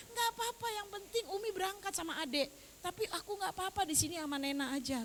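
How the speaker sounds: small, high voice of a crying child, imitated; imitating tearful grandchild. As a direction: retell the grandchild's tearful reassurance. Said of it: "cucu saya kok ngomongnya begini".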